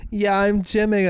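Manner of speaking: high, mock-defiant reply; stubborn insistence. Sick partner insisting on the gym, stubborn.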